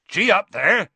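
loud, high driving roar. A narrator's reading. Hagrid urging creatures towards the fence, roaring. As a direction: roar to urge animals on. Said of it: "he roared".

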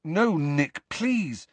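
high-pitched urgent plea; pleading boy. A narrator's reading. Harry begs Nick to stay and talk, pleading.

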